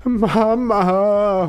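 embarrassed son wailing at his mother; wailing Mama. Acted. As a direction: wail at her like a caught teenager. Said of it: "heulte Chris".

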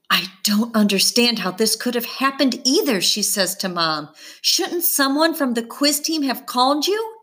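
a teacher demanding answers; mild stern outrage; stern indignation. A narrator's reading. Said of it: "Her voice could crush bricks".

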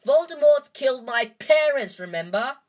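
defiant outburst to his friends; shouting. A narrator's reading. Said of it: "Harry shouted".

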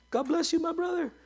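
lightly voiced sugary high churchgoer greeting; mock churchgoer. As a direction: ape a falsely sweet believer.

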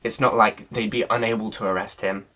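usual speaking voice

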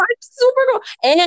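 high-pitched gushing quote; mock fangirl squeal. Fan recalling meeting the Supergirl actress, high mock fangirl gushing. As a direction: squeal it like a starstruck teen.

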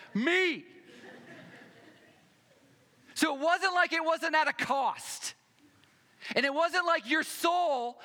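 loud, high-pitched sermon at full force; preacher in full cry; impassioned preaching. Real, not acted. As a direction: drive each point home to the congregation, loud and insistent.

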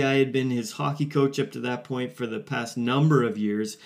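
ordinary talk, nothing marked

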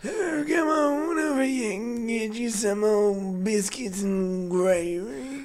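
put-on slow, high creaky old man. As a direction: drag it out like a frail old man. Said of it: "Do it in your old man voice".

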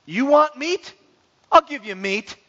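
taunting, rising offer; taunting God. Acted. God answering complainers about meat, taunting. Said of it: "So God says".

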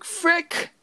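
high squeaky curse of alarm; a sudden high frick; squeaked curse; dismayed yelp. Retold or imitated. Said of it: "I'm like".

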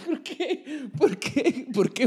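high, laughing disbelief. Real, not acted. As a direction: ask it while cracking up. Reacting to the absurd review.

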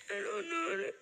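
numb from the dentist, slurring; numb mouth. Real, not acted. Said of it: "I can't feel my mouth".

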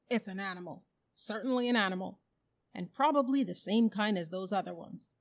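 lightly voiced, emphatic bulldog; insistent bulldog. A narrator's reading. Bulldog reports to the other animals on what it sniffed, insistent. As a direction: state it firmly and loudly as a bulldog.

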